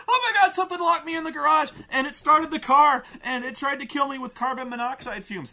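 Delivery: put-on high, loud, fast frantic teen; breathless panic, words tumbling out; panicked girl. Girl running screaming to her dad, high frantic panic. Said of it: "and she comes running and screaming".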